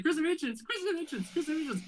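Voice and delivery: strained-up pitch, half-volume calling; retold yelling. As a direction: re-enact calling after someone, over and over. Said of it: "So I'm yelling".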